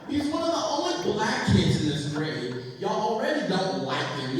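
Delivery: indignant mother. Retold or imitated. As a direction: play a mother tearing into the principal. Mother angrily defends son to principal.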